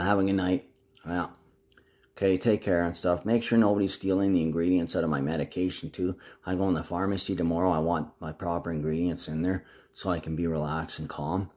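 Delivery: normal talking voice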